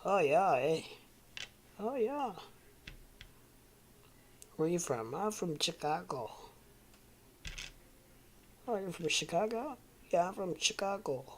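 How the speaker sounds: exaggerated Northern US accent. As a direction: play two Northerners chatting, accent laid on thick. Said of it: "I like the Northern accents".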